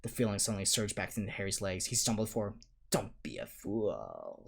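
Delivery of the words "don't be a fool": low, slow snarl; slow low snarl from the evil face. A narrator's reading. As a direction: snarl it slowly from deep down. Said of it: "snarled the face".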